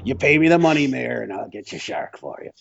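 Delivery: a hint of a grizzled shark hunter; Quinn impression. Quoting Quinn from Jaws.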